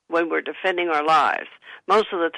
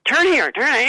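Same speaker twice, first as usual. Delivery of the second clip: higher, louder mock bossy order; imitating a yell; 7 semitones higher, 4 dB louder, slower than usual. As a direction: snap out directions like a grumpy passenger. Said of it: "when they start yelling".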